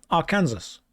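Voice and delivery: "Arkansas" as ar-KAN-zus; mock mispronunciation. Shown as a demonstration. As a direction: read "Arkansas" like Kansas. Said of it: "How do you say Arkansas".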